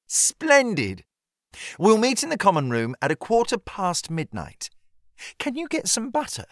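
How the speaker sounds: put-on high, bright-eyed girlish voice; a scandalised, thrilled young lady; lightly voiced eager schoolgirl. A narrator's reading. Molly teasing Harriet about the midnight swim, high girlish voice.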